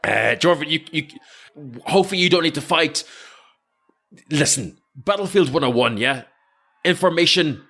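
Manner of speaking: lightly acted battlefield pep talk; commander Zoran briefing. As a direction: urge the runner on, point by point. Game master plays commander briefing runner.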